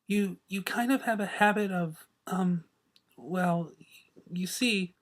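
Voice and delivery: soft, shy, stumbling over words; nervous stammer. A narrator's reading. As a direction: stammer it out, timid. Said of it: "Fluttershy's nervous stuttering".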